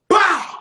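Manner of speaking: loud, high "bah" gun blast; sudden shouted bang; imitated gunshot. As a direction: burst out a mock gunshot noise. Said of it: "like a real gun".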